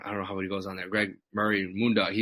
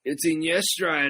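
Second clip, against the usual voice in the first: lightly mimicked drunk fan, drawn out and higher; slow, raised-pitch imitation of a rowdy greeting; 7 semitones higher, slower than usual. Drunk bar-goer spotting the wrestler, mock rowdy greeting. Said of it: "especially when people are drunk".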